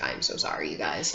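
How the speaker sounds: apologizing to family while crying; weak apology. Retold or imitated. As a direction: barely get the words out. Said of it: "I was just like".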